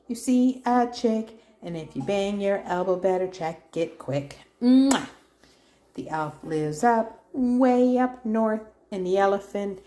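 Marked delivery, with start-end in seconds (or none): 4.6-5.1 kiss sound. Real, not acted.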